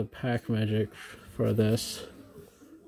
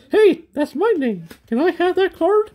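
put-on much higher, louder little-kid voice; imitating his son; 6 dB louder than usual. Dad voicing his son who wants the card, high kid voice. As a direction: play the six-year-old pleading for the card. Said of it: "my son's looking at me li-, right now going".

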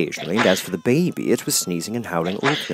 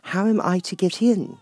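Alice; 11 semitones higher than usual. Acted. Alice character voice.

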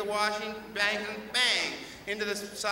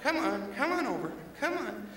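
calling kitty; 6 semitones higher, 6 dB quieter than usual. Acted. Calling cat sweetly.

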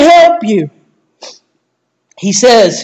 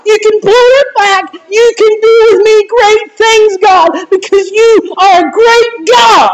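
high, crying voice lifting to a shout; tearful prayer; 4 semitones higher than usual. Retold or imitated. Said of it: "And I said".

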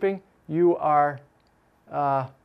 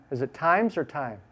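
usual speaking voice

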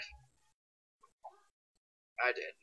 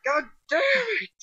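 high screaming rage at the game; raging gamer; 3 dB louder than usual. Real, not acted. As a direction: shriek it out in full fury. Gamer raging at rule-breaking cheat.

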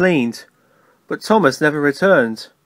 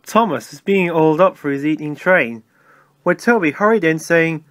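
plain reading voice

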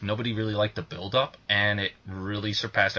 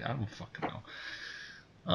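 muttered aside; 16 dB quieter, much slower than usual. Real, not acted. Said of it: "trailing aside".

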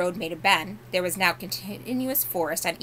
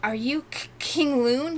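gasping; 7 semitones higher, 3 dB louder, slower than usual. A narrator's reading. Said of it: "gasped Shasta".

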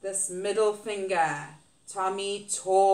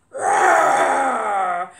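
loud hammed-up roar; mock dinosaur roar; 11 dB louder than usual. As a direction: roar big for the children.

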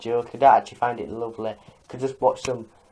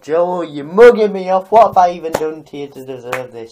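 exasperated complaint; 4 semitones higher, 9 dB louder than usual. Acted. Roasted YouTuber complains to Joe, exasperated. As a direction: plead your case in loud exasperation.

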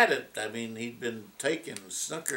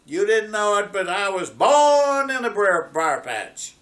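higher, louder gleeful crowing; gleeful taunt; 8 semitones higher, 8 dB louder, slower than usual. Acted. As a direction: gloat from safety in the briars. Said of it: "started cackling and laughing, gleefully".

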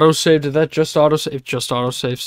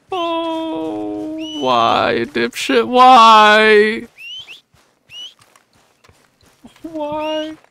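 mock anguished wail; 13 semitones higher, much slower than usual. Real, not acted. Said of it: "I'm genuinely so upset right now".

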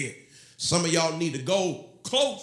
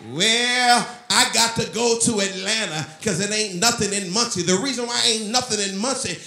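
high-pitched, insistent preacher's call; fervent preaching; 5 semitones higher, 4 dB louder than usual. Real, not acted. Pastor urging the town's saints to pray, fervent preaching. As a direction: keep the sermon's fire up and drive it home.

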